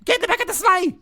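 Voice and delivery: very high loud shouted punchline; shouting. Acted. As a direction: yell it in a squeaky voice. Cracker joke punchline shouted.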